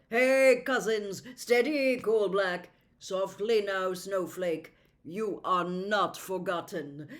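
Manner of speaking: loud yet soothing calls to horses. Acted. Prince calming the frightened horses, firm soothing calls.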